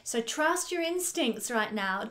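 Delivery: usual speaking voice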